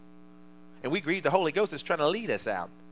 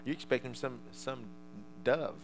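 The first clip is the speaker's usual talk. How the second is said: normal talking voice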